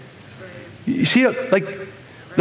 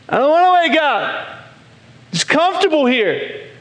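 higher sulky child voice, imitated; whiny kid; 7 semitones higher than usual. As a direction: whine like a kid who won't get up. Child refuses to get up.

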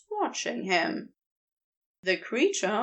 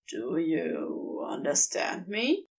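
plain reading voice

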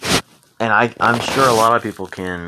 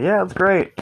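fake cheerful lie; 9 semitones higher than usual. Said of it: "I'm gonna be all like".

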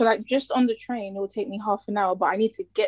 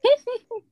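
laugh; 6 dB louder than usual. Real, not acted. Laughing at traffic story.

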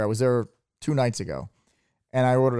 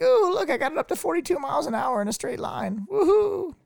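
very high mocking squeal ending in a higher woohoo; mock excitement. Host mimicking friend bragging on a jet ski, high-pitched mock glee.

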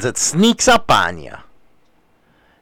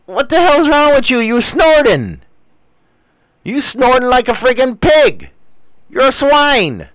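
mock scolding; 9 semitones higher than usual. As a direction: jeer at her like a pig-caller. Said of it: "It's like".

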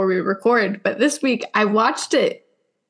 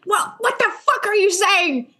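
mock angry mom; 9 semitones higher than usual. Imitating mom angry in Italy.

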